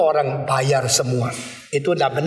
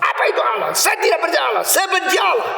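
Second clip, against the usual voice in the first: furious shout; 11 semitones higher, faster than usual. Retold or imitated. Young atheist storms into pastor's study, raging high shout. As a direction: storm in yelling your hatred. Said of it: "Dia marah-marah, dia ngamuk".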